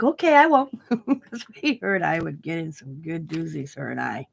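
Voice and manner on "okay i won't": higher, louder teasing promise; playful promise. Retold or imitated. Grandma teasing granddaughter back, playful promise. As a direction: promise with a grin in the voice. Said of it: "And I'm like".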